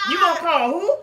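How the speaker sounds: high, loud, through laughter; mocking the man's reply, laughing; laughing mock quote. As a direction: laugh while quoting him.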